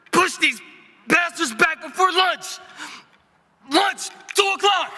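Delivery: shouting in battle. Acted. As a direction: bark warnings over gunfire. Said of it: "let me just see what you do with this line".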